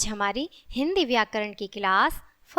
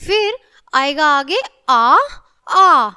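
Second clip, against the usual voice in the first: put-on a long wailing aah like a crying child; loud drawn-out crying aah; crying aah demo; 7 dB louder, slower than usual. Shown as a demonstration. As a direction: cry out the aah for the class. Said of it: "जब रोते हैं तो sound ऐसे ही निकलता है ना आ".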